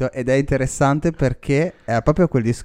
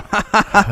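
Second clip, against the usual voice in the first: laugh; 12 semitones higher, 7 dB louder than usual. Real, not acted. Burst of laughter.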